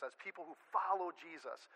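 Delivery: usual speaking voice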